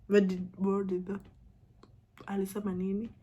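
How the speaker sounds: ordinary talk, nothing marked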